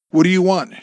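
high, loud tense demand; strained, angry question; gritted out. A narrator's reading. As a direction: force the question out through gritted teeth. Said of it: "she gritted out".

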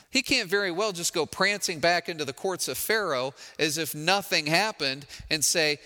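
ordinary talk, nothing marked

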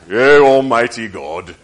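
loud, slow, choked-throat holy tone; strained pompous prayer voice. Retold or imitated. Preacher mocking showy prayer, strained and loud. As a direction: pray like a donkey kicked you in the throat. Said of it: "as if a donkey's kicked you in the throat".

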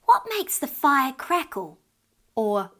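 lightly voiced curious child; curious little girl. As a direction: ask it wide-eyed like a little girl. Child asks tricky question.

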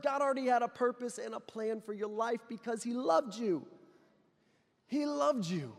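gentle preaching, falling on loved; tender sermon voice. Acted. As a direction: linger on loved softly.

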